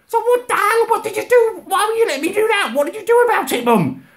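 very high, frantic questioning; appalled. Retold or imitated. Demanding answers from mum, appalled. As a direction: fire questions at her in high-pitched outrage.

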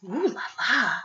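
whispered, impressed; ooh la la. Acted. Teacher playing up the classy shell, whispered and admiring. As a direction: breathe it out in a hushed whisper.

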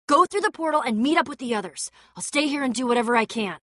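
ordinary talk

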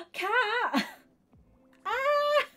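gasping, high, loud squeals of surprise; excited shrieking. Real, not acted. Streamer thanking a friend for a surprise raid, shrieking excitedly. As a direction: scream with delight, stretching the words out.

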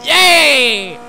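high, loud, drawn-out yay; excited cheer. Real, not acted. Cheering a jump in game.

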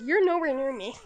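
ordinary talk

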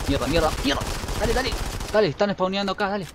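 loud, high, urgent yelling; frantic shouting. Real, not acted. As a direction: yell at the screen in panic. Enemies spawning, frantic shouting.

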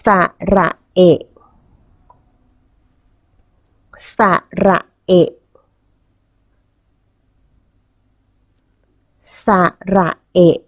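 สระเอะ in spaced syllables, short final เอะ; model pronunciation. Shown as a demonstration. Teacher asks children to repeat after her, model pronunciation of short สระเอะ. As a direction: say สระเอะ carefully, cutting the last vowel short. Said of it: "เด็กๆพูดตามคุณครูเลยนะคะ".

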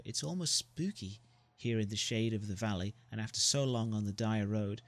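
usual speaking voice

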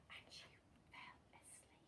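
storyteller's sleepy whisper; barely audible hush. A narrator's reading. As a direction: whisper it very softly, as if lulling. Storyteller hushes as Goldilocks falls asleep.

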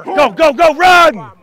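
loud urgent yell to run; coach shout. Real, not acted. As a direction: drive the player on with a shout. Coach urging player during drill.

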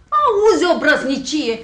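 high, loud scandalised exclamation; affronted outburst; indignant outrage. Acted. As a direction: exclaim at the insolence. Woman outraged at his claim.

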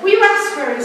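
put-on high-pitched, hesitant go at a Russian accent; attempted Russian accent. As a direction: try a Russian client's accent, pitched well up. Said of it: "I can't do a Russian accent".

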